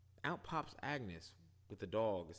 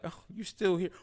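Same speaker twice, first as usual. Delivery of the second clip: dismissive; 11 semitones higher, 10 dB louder than usual. Retold or imitated. Wanda brushing off Monica.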